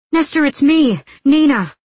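high, loud call to a dazed friend; urgent appeal. A narrator's reading. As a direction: try hard to get through to him. Nina urges dazed friend to recognize her.

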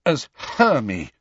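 snorting scorn. A narrator's reading. Hermione reports the giant's name for her, scornfully. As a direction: draw out the nickname with scorn. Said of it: "she snorted".

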